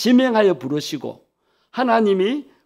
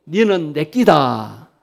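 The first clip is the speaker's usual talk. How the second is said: slow, drawn-out Gyeongsang accent; Gyeongsang dialect; 3 semitones lower, 4 dB louder, much slower than usual. Shown as a demonstration. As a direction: say it like someone from Gyeongsang, stretching the words. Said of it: "경상도 버전으로 하면".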